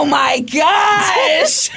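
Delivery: high laughing oh my gosh; laughing exclamation. Real, not acted. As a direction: burst out laughing, caught out. Laughing reaction to being called out.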